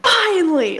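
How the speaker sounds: high, drawn-out outburst; retold yell. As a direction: re-enact an exasperated yell that slipped out. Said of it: "I practically yelled".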